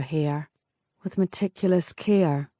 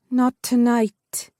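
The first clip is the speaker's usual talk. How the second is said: higher heroine voice, slow and firm; tender defiance; 6 semitones higher, 4 dB louder, slower than usual. A narrator's reading. Heroine contradicting him.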